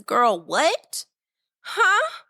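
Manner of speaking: high disbelieving huh. Real, not acted. Podcaster reacting to lyrics, stunned disbelief.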